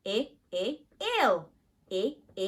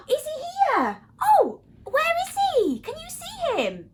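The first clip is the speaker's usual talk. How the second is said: surprised gasp; 6 dB louder than usual. Acted. Thinks Ziggy has appeared.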